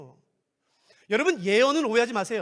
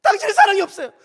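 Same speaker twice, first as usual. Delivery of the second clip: higher, louder wounded reproach; the merciful member accusing the prophet, higher and louder; hurt accusation; 10 semitones higher, 11 dB louder, faster than usual. Acted. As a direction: throw it back at them. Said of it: "궁율이 말하죠".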